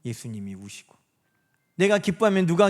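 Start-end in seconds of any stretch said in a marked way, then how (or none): 0.0-1.0 soft, low answer, much quieter; 예수님이 said low and soft as the answer; hushed answer. Shown as a demonstration. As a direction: drop to a near murmur on 예수님이. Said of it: "내가 울면 누가 울어요".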